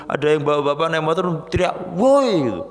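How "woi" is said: loud, high angry yell; shout. Retold or imitated. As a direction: bark it at the rider. Motorist shouting at him. (teriakan keras)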